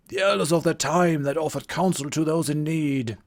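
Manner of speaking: put-on a theatrical old druid greeting; old wizard voice. Game master voicing Merlin greeting the Vikings, old wizard voice. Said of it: "the voice that I'm giving him".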